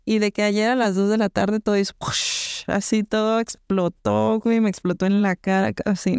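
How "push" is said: breathy unvoiced push, like a blast; explosion sound. Telling a friend how work exploded, soft puff of a blast. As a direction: blow it out as a soft burst of air.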